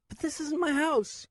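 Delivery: put-on high, slow boy's voice near tears; tearful child. Nico dropped off alone, high tearful child. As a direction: hold back tears as a scared boy. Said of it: "I held back tears".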